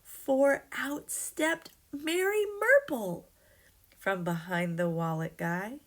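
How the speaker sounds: plain reading voice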